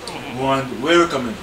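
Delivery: normal talking voice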